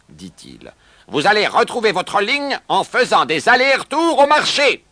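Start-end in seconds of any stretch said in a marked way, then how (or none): 1.1-4.8 high, loud outburst of disgust; angry scolding. A narrator's reading. New owner berates the horses, angry scolding. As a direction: berate them at full voice.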